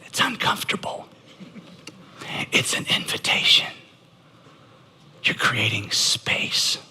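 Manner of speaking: near-whisper into the silence; soft, breath-led words with long gaps; hushed. Real, not acted. Pastor letting the congregation feel the silence, hushed. Hush after the practised apology.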